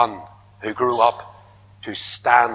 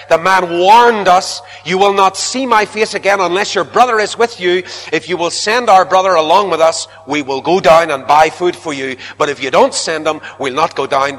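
Judah pleading firmly; 5 semitones higher, 5 dB louder than usual. Acted. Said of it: "And Judah said".

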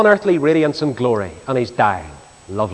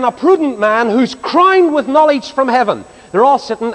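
normal talking voice

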